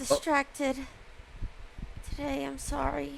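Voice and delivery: very soft, hesitant mumble; meek student excuse. Acted. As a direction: shrink into a small, halting apology. Student excusing himself to teacher.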